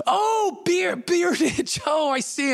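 very high, drawn-out realisation; high Tom Hanks impression, coming to the rescue; overplayed understanding. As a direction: act delighted to finally get the joke. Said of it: "Tom Hanks tries to rescue me".

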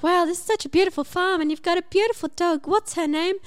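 high, fast, breathless gushing over the farm; gushing excitement. Retold or imitated. Narrator gushing to the farmer about his dog, high and quick. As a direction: gush like a smitten visitor, quick and high.

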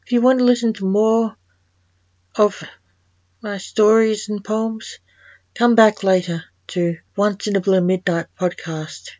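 loud, bright sign-off to the listeners; host thanking listeners in a big announcer voice; podcast outro. Acted.

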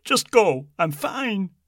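dismissive. A narrator's reading. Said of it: "flapped a hand".

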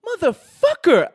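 high, loud and slow angry outburst; furious curse. Retold or imitated. Director, furious at being lied to, cursing loud and high. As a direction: spit out the curse in disbelief. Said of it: "the director was pissed".

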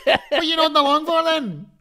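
high, loud incredulous laughing demand; mock indignation. A sweaty passenger mock-scolding his nodding seatmate, high and laughing. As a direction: act outraged but amused. Mock outrage at the deaf woman.

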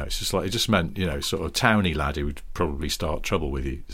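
usual speaking voice

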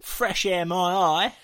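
high, hammed-up English accent; loud mock attempt at an English accent; American's English accent. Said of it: "doing English accents".

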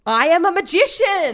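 high, loud proclamation through laughter; giddy triumph. Retold or imitated. Teller announcing herself a magician after the trick worked, giddy triumph. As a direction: crow it, bursting into a laugh.

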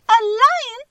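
very high squeak of alarm; high-pitched little mouse cry; crying out, mouse queen. A narrator's reading. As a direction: squeak it out as a startled tiny queen. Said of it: "cried the little queen".

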